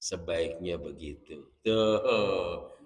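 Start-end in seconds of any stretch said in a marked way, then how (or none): 0.0-1.5 low, soft sebaiknya begitu; calm saint's reply. Retold or imitated. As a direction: answer as a gentle elder. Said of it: "Kata Sunan Ampel".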